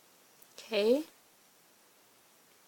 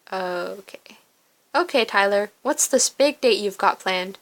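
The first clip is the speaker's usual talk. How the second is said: ordinary talk, nothing marked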